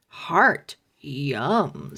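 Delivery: low hungry zombie. Acted. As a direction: savor it like a ghoul. Zombie dummy voiced.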